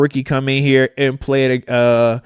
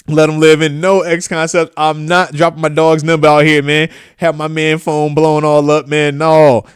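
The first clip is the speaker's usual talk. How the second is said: normal talking voice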